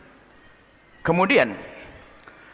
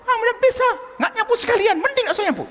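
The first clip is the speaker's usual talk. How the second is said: scolding mother; faster than usual. Retold or imitated. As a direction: sneer at the child's sweeping.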